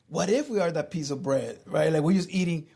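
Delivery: ordinary talk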